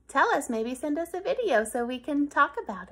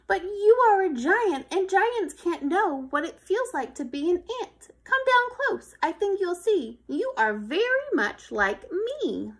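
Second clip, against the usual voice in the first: a lightly voiced, higher pleading ant; earnest ant; 5 semitones higher, 6 dB louder than usual. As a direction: coax the giant to come close.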